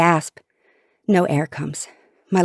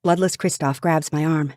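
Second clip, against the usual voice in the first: ordinary delivery, nothing marked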